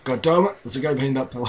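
loud, high frustrated outburst; excited frustration. Real, not acted. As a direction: blurt it out, startled and annoyed. Frustrated in firefight.

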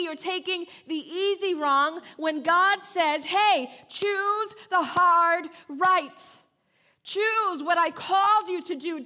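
loud, slow, rising sermon call; preaching at full force. Acted. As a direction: urge them hard toward the hard right. Preacher voicing God's call.

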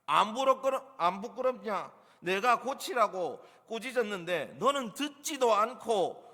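high, loud, indignant scolding; master's stern rebuke. Acted. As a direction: reprimand a servant, hammering out each charge. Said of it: "훈계한 말입니다".

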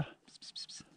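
soft whispered pss sounds for the cat. Real, not acted. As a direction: whisper the lure, barely a breath. Luring cat with whispered hissing. (viskat pss-lockande till katten)

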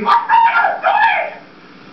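alarmed shriek. Acted. Bloom shrieks at Max.

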